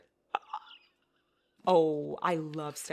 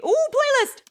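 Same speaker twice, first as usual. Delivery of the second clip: much higher and louder burst of delight; excited squeal; 13 dB louder than usual. Real, not acted. Spotting the book's playlist, she squeals with delight. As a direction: squeal it like you just found treasure.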